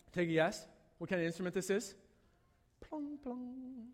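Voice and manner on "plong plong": soft high plucking sound, like lyre strings; playful imitated lyre pluck; plucked string noise. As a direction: mouth a twanging lyre, gently.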